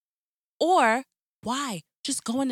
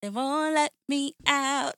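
ordinary talk, nothing marked

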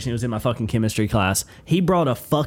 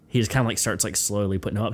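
normal talking voice

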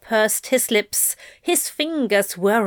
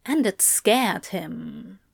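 ordinary delivery, nothing marked

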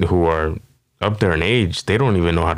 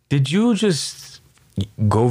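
way higher and slowed, disbelieving; incredulous high squeak; 7 semitones higher, slower than usual. Real, not acted. Comedian asking the absent guy, high and incredulous. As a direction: stretch it out high, like you can't believe it.

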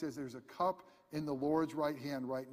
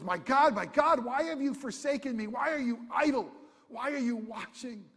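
loud, high forsaken wail; anguished cry from the cross; 8 semitones higher, 8 dB louder than usual. Retold or imitated. Jesus on the cross crying out to God, loud and high. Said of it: "'cause he cries out".

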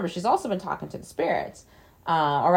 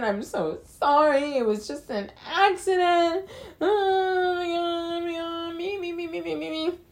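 mock begging sobs; 12 semitones higher than usual. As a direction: ham up a blubbering plea that turns into a sung whine. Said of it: "begging and pleading with her".

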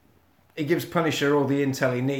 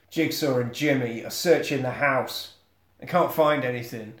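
usual speaking voice

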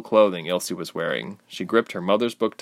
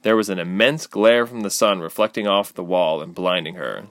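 plain reading voice

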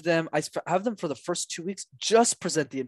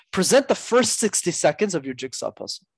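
normal talking voice

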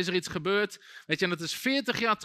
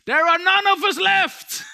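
high, loud demonic shriek in English; a demon yelling from the woman on the floor, loud and high; demon screaming; 12 semitones higher, 10 dB louder, slower than usual. Retold or imitated. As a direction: scream it like something tearing out of her. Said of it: "die vrouw op de grond schreeuwde nog".

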